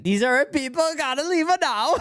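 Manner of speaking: exaggerated Italian accent, high; heavy Italian accent. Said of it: "in the heaviest Italian accent".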